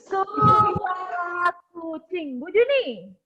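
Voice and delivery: loud, high, slow announcement; calling out. Real, not acted. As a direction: call it out like a game host. Calling out animal sound turns.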